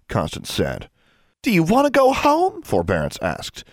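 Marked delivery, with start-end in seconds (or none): 1.4-2.6 high, loud warning question; high-pitched exasperated reproof; scolding. A narrator's reading. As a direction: warn a little sister sharply, pitched up. Sister threatens the younger one.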